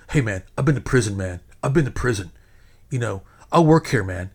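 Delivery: imitating a loudmouth, loud and insistent; skinny loudmouth. Skinny guy warning the man behind him, loudmouth bluster. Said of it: "Then skinny loudmouth guy".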